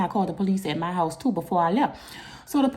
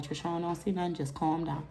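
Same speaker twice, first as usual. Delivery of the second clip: soft, low, soothing sister; calming pep talk; 3 semitones lower, 11 dB quieter than usual. Retold or imitated. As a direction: talk her down very quietly. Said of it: "she giving me a pep talk".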